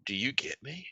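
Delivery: quiet mock-earnest question; soft acted question. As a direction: ask it very softly, rising at the end. Acted quote, soft first take.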